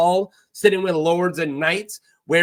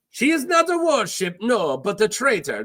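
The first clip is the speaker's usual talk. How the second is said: high, emphatic put-on foreign accent; pirate accent, launched high and settling; Salladhor Saan; 5 semitones higher than usual. Pirate corrects himself about his ship, in a strong accent. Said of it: "Now I got an accent".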